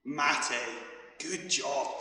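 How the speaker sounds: ordinary delivery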